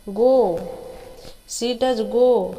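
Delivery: "does" pressed hard and slow, "go" falls; stress on does. Shown as a demonstration. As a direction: lean into "does go" to insist. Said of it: "क्योंकि ये जोर देकर बोला गया है". ("does" पर ज़ोर, धीरे और ज़ोर देकर)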